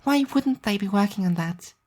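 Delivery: put-on high, airy girl's voice for Alice; Alice's voice. A narrator's reading. Narrator voicing Alice musing about new rats, high girl's voice. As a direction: voice the odd, dreamy friend lightly and high.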